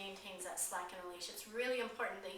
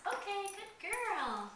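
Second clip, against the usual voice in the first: much higher, slow, sing-song praise; lavish praise on the puppy, drawn out; excited dog praise; 10 semitones higher, 3 dB louder, much slower than usual. Shown as a demonstration. Trainer praising puppy for ringing the bell, high slow praise. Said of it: "and when she does".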